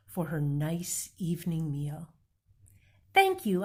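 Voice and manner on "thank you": very high, bright thanks; police officer. Acted. Officer thanks Amu for the stew, very high and bright. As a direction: thank her cheerfully. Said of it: "the officer said".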